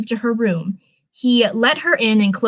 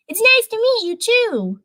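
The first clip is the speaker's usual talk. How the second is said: put-on much higher, sweet kid reply; high child voice; 12 semitones higher than usual. Twins answer the new adults in a high child voice. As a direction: pitch up like a shy ten-year-old.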